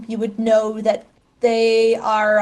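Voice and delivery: ordinary delivery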